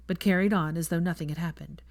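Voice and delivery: usual speaking voice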